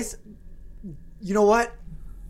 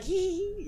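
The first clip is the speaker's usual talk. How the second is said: nervous giggle; 12 semitones higher, 6 dB quieter than usual. Acted. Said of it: "I'm just like".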